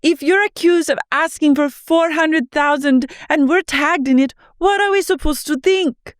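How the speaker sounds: squeaky mock question; high parody of a castmate asking; imitating Whitney. Mimicking Whitney.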